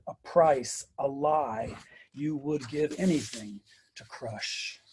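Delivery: measured, emphatic poem recitation; poetry reading. Acted. Poet reads praise song for Harriet Tubman to audience, measured recitation. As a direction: recite with weight, pausing between numbered sections.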